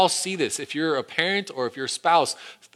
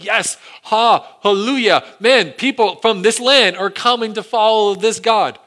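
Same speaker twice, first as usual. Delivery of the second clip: higher and louder, overjoyed; joyful hallelujah; 7 semitones higher, 7 dB louder than usual. Retold or imitated. Israelites cheering the Gibeonites coming to worship, joyful. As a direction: cheer like welcoming new believers. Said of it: "They're like".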